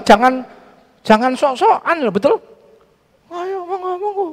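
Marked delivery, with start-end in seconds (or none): 3.3-4.2 high, soft courteous invitation; polite Javanese greeting to villagers. Shown as a demonstration. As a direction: invite them in gently and politely. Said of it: "itu sopan".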